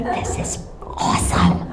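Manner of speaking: whispered under the breath; hushed, so he won't hear; sneaky whisper. Retold or imitated. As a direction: secret whisper while filming. Sneakily filming son through the door.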